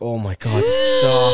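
squealed "stop", way up high; high disgusted shriek. Retold or imitated. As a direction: shriek it like you can't bear it. Disgusted reaction to singer.

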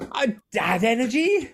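high, incredulous squeal of disbelief; mock disbelief. Streamer, told he has dad energy, squeals in mock disbelief. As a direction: play it like you can't believe your ears. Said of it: "I'm like".

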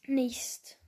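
short whispered word. Real, not acted. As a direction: breathe it out unvoiced. Whispered aside after false sneeze.

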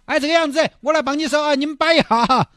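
eager high father. Acted. Father eagerly gives daughter away.

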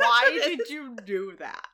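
slow, loud mimicked protest; imitated cry of dismay; mock outraged yell. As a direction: drag it out in hammed-up disbelief. Co-host mimics the outraged line.

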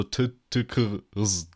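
"t, t, k, s" as bare consonants, slowly; consonants only. Shown as a demonstration. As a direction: sound out "t, k, s" with no vowels. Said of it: "dann ist da einfach nur so". („t, k, s“ langsam als reine Konsonanten)

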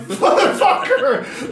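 high, loud, drawn-out curse; comic retold outrage; exasperated shout. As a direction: yell it slowly, half laughing. Reaction to the repeated heckling.

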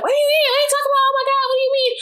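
mock her as a flustered, clueless supervisor; mocking high flustered voice.

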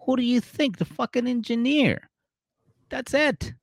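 high mock answer; voicing Cam. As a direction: pitch way up as Cam snaps back. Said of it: "And he's like".

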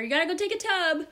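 high-pitched mock motherly call; yelling mom. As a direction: imitate a mom ordering bath time. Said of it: "she started yelling for me".

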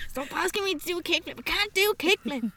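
high, fast exasperated whine; whiny protest. Retold or imitated. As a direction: complain like a fed-up teen. Whining at imagined kids.

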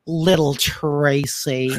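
slow, soft "Little Tracy" in a light American accent; flat English version. Shown as a demonstration. Showing how the English dub says the nickname, slow and soft. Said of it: "Pero pag English".

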